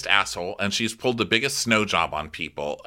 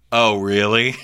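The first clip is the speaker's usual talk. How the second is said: ordinary talk, nothing marked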